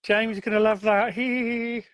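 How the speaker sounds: high, mock-giggly schoolgirl voice; giggling little schoolgirls. Podcaster mimicking schoolgirls caught on the video's audio, mock giggling. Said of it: "giggling little schoolgirls going".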